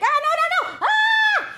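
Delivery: high, panicked cry of protest; panicked yell. A narrator's reading. Pikachu panicking as Squirtle closes in, high yelling. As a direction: shriek it out in alarm. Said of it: "she yelled".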